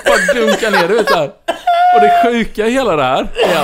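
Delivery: high and loud, laughing through the words; real amused laughter while speaking. Real, not acted. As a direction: tell it while cracking up. Laughing retelling the fall.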